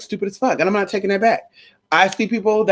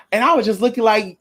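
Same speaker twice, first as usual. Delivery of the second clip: normal talking voice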